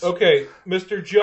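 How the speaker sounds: high, loud placating reply; snowplow driver. Acted. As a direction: pitch it up as a put-upon driver.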